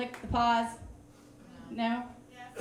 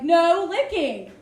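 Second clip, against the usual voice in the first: stretched out, louder, slow order to the dog; slow firm command; 2 semitones higher, 6 dB louder, much slower than usual. Retold or imitated. Owner slowly ordering her dog to stop licking, firmly. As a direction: draw out each word to make the dog stop. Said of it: "So I'm like".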